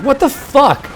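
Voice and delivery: high, incredulous mock outburst; retold outrage. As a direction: fling it out in disbelief. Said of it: "I was so pissed".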